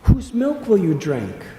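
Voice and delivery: high-pitched, indignant scolding questions; reproachful. A narrator's reading. Gopis challenge Krishna about leaving his mother, reproachful. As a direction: raise the pitch and chide the child.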